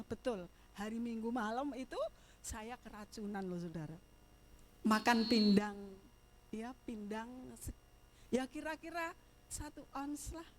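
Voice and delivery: usual speaking voice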